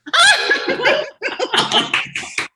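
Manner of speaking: loud, very high burst of laughter; delighted squealing laugh. Real, not acted. Comic hearing praise for her stand-up, delighted squealing laugh.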